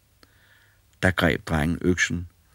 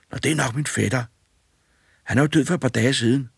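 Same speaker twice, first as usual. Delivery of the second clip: put-on boy's voice, higher and louder; cheeky boy; 8 semitones higher, 3 dB louder, faster than usual. A narrator's reading. Fearless boy bossing the ghosts, cheeky and loud. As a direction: bold lad ordering the ghosts about. Boy jokes about coffin.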